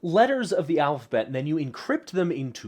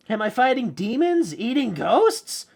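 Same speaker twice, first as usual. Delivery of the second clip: Bill's question in character; 10 semitones higher, 5 dB louder than usual. Acted. Bill's question voiced within poem.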